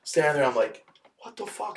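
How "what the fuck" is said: retold startled reaction. Acts out his startled reaction.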